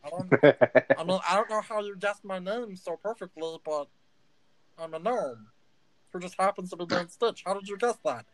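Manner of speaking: a little gnome spiralling into a crisis; panicky gnome Stitch. Acted. Gnome Stitch freaks out that a stranger guessed his name.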